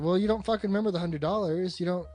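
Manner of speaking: ordinary talk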